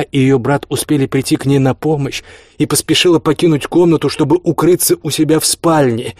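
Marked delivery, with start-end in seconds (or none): none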